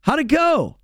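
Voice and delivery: very high, chipper question; dad asking his kid about the bike, high and chipper; eager dad. Retold or imitated. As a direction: pop it up brightly like a keen dad.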